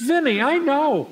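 high, slow put-on voice; imitated all-knowing reassurance; knowing valet impression. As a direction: draw it out high, as the valet cutting in. Valet cuts him off in story.

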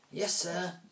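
high, eager yes-man voice; obedient sidekick. Acted. Sparky answering Alvis, high obedient sidekick. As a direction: snap to attention. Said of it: "Sparky said".

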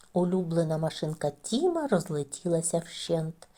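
plain reading voice

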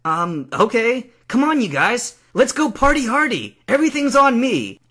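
loud, high, building party call; rally everyone excitedly, building to a near shout; celebrating. Acted. New champion invites friends out to celebrate, excited.

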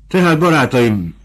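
plunging straight in. A narrator's reading. Fabricio turns to his new friends for help, plunging straight in. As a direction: launch straight in without deliberating. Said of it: "vágott bele Fabricio fontolgatás nélkül".

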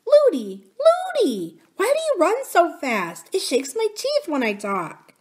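put-on high, whining little girl calling out; chattering child princess. A narrator's reading. Princess to nurse who runs too fast, high childish complaint. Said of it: "She kept on chattering as well as she could, but it was not easy".

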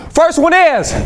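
First one is thrown high with big energy; energized call-out. Shown as a demonstration. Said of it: "I need to say it like this".

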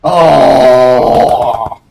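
long, loud wail; drawn-out cry of dismay; loud groaning oh. Real, not acted. Gamer reacting to the play, loud groaning oh.

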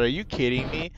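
usual speaking voice

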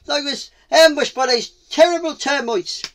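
loud, high complaint; aggrieved cheetah. Acted. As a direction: protest about the ambush.

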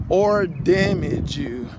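ordinary talk

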